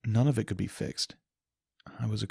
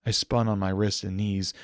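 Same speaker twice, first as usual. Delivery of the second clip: ordinary delivery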